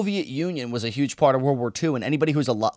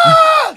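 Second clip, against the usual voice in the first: very high, loud scream; hammed-up labour scream; mock birth scream; 10 dB louder than usual. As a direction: shriek as if giving birth. Mimes screaming in childbirth.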